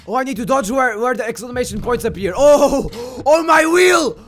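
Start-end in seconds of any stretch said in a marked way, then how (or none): 2.4-4.2 high, loud cry of dismay; panicked gamer outburst; alarmed shout. Real, not acted. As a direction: yell as your ride falls apart. Yelling as the game goes wrong.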